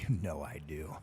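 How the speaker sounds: breathy hushed whisper. Retold or imitated. Whispering back to Sheldon's offer. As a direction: whisper it like a secret yes. Stoned reply to Doctor Pepper offer.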